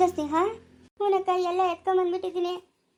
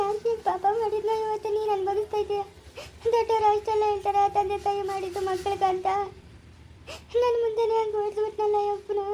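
crying as she laments; tearful lament. Acted. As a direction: lament through tears, voice slightly raised. Acted lament after being beaten. (ಅಳುತ್ತಾ ಗೋಳಾಡುವುದು)

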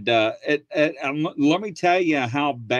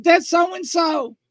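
high, slow, loud call-out; shouting audience; 5 dB louder, slower than usual. Retold or imitated. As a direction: shout it across the room. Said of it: "They're like".

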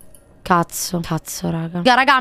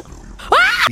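very high scream cutting speech off; startled shriek. Real, not acted. Streamer apologising in the game, startled shriek. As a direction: shriek mid-sentence. Jump scare while playing.

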